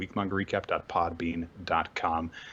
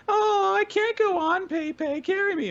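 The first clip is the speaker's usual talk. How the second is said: bratty whine; 5 dB louder than usual. Retold or imitated. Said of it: "'cause she's like".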